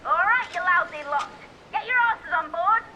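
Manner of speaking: high, loud rough-and-ready call to a crew; shouted order. Acted. Captain orders lousy lot on board, shouted order. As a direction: holler at the crew to get moving.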